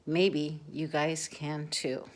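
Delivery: ordinary delivery, nothing marked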